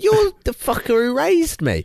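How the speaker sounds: high-pitched comic outrage; hammed-up indignant comeback; mock indignant retort. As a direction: throw the blame back, exasperated. Retold row with mum.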